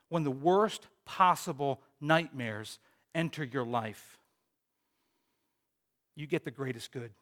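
usual speaking voice

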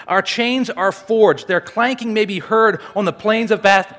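emphatic, measured oratory; dramatic speech reading. Acted. Speaker reading a revolutionary speech about liberty, emphatic oratory. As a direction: argue it forcefully to an assembly. Reads third famous speech.

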